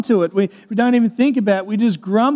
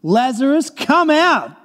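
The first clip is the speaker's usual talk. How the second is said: higher-pitched commanding call; summoning call, raised in pitch; loud calling out; 4 semitones higher, slower than usual. Acted. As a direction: call him out with authority, pitch climbing. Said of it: "called out in a loud voice".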